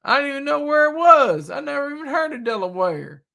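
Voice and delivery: high-pitched, hammed-up Southern voice; exaggerated Southern accent, all amazement. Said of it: "I'm using a Southern accent".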